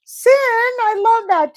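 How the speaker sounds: high, loud, slow delight. Real, not acted. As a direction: gush with delight. Delighted by viewer's nickname.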